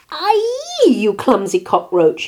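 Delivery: high yelp dropping into a loud scolding; angry shout. A narrator's reading. Rooster has coffee spilled on him, angry shout. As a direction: cry out, then scold loudly. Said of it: "he shouted".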